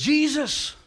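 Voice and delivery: high, loud cry of the name; calling out. Acted. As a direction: call to someone you've run to meet. Martha calls out on seeing Jesus.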